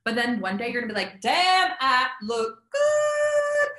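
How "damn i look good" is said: high, stretched-out "damn, I look good"; drawn-out smug mirror brag; cocky self-praise. Pretend. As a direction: admire yourself out loud, hamming it up. Mock confident self-talk in mirror.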